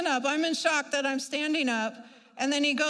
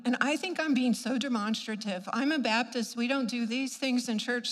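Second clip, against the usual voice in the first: ordinary talk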